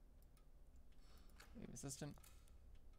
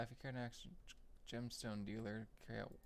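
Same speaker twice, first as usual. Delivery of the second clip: normal talking voice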